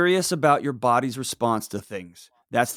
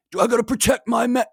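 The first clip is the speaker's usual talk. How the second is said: high-pitched mock worry; mock defensive; 10 semitones higher than usual. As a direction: put on a high, fretting voice. Mocks a needlessly defensive reaction.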